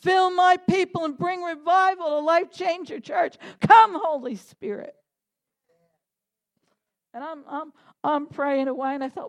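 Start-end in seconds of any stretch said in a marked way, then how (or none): 0.0-5.0 loud fervent prayer. Retold or imitated. Pastor praying loudly for her church to be filled. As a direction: cry out for revival with full force. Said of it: "I'm asking".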